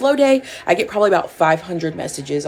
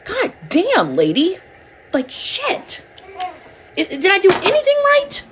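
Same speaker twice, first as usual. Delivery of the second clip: exasperated outburst; 14 semitones higher than usual. Retold or imitated. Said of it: "And it's like".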